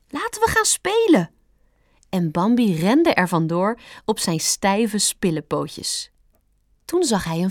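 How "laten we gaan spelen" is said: very high, eager rabbit voice; squeaky invitation to come and play; Stampertje calling out. A narrator's reading. Rabbit inviting the fawn to play, calling out. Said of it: "riep Stampertje".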